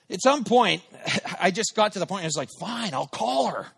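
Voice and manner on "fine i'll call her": higher, drawn-out grudging surrender; exasperated giving in. Retold or imitated. Worn down by the nagging thought, exasperated surrender.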